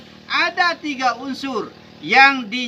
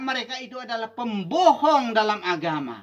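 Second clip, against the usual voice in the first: ordinary delivery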